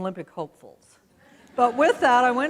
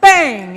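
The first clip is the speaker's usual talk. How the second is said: gunshot bang; 4 semitones higher, 14 dB louder than usual. Retold or imitated. Imitates gunshot.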